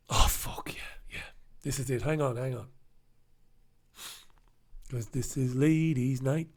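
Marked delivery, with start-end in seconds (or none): 0.1-1.2 hushed aside; whisper. Real, not acted. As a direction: whisper it under breath.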